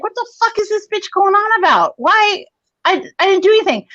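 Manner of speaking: high-pitched acted-out indignant bewilderment; stunned disbelief. Stunned eight-year-old reacting to mom's yelling, high bewildered protest. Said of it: "I was stunned".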